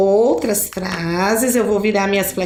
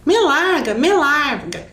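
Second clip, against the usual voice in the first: read-aloud mock yell of a scared boy; shouting; 4 semitones higher, slower than usual. A narrator's reading. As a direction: shout to be let go. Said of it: "gritava Milton".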